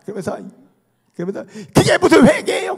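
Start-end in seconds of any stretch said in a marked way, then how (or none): 1.7-2.8 high, loud mock indignation; imitated exasperated shout; child's angry protest. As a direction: throw it back like an outraged kid. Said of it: "걔가 막 성질 내면서".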